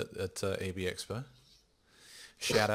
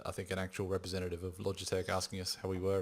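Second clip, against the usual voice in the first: ordinary talk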